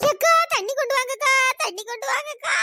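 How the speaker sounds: high, loud plea for water; desperate calling. Acted. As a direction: call out in distress after a salty mouthful. (தவிப்புடன் உரக்கக் கூப்பிடுதல்)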